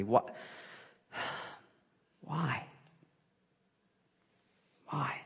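breathy hushed despair; whispered why. Retold or imitated. Preacher reliving desperate prayer, whispered. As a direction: let each why fade into a whisper.

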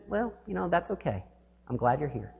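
calm him down gently; reassuring toll lady. Retold or imitated. Toll lady comforting frantic driver, soft soothing voice. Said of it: "And she said".

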